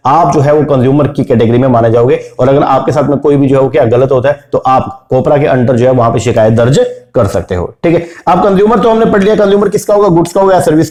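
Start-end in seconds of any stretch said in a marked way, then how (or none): none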